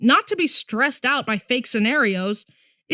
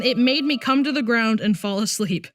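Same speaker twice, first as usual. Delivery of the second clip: ordinary talk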